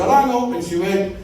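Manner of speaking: normal talking voice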